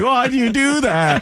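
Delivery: high, teary whine, half laughing; whiny mock crying. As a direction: whimper it like a kid about to cry. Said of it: "I would cry".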